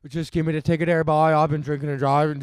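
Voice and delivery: mock drunk Newfie; hammed-up Newfoundland drunk; bad Newfie accent. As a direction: put on a rough Newfoundland accent, badly. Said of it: "that's a pretty bad Newfie accent".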